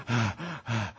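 heavy acted panting; catching breath. Preacher as the tired rescuer, heavy mimed panting. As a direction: puff hard as if winded. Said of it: "as you're catching your breath".

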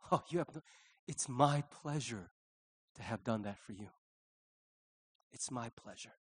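soft, low and unhurried; tender reassurance. Acted. God answering our thanks, tender and quiet. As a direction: let it sink to a gentle hush.

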